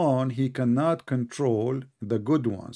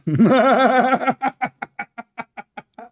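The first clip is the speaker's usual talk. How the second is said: high, loud burst of laughter; 11 semitones higher, 9 dB louder than usual. Real, not acted. Laughing at his opponent after the jab, loud. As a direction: howl with laughter.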